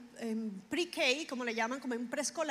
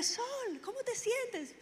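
high, soft sing-out greeting; much higher, lightly called greeting; retold greeting; 11 semitones higher, 4 dB quieter, slower than usual. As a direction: imitate a friendly high-pitched hello to a friend. Greeting a friend across the street.

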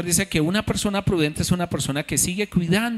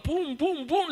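imitated thuds; 11 semitones higher, 4 dB quieter, slower than usual.

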